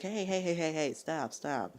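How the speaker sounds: high, quick calls to break it up; intervening. Retold or imitated. Breaking up a fight between friends, intervening.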